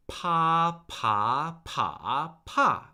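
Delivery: "pa" level, rising, dipping, then falling; four Mandarin tones. Shown as a demonstration. Teacher recapping the warm-up, "pa" in four contrasting tones. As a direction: run "pa" through all four tones in order. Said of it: "the four tones of Mandarin Chinese".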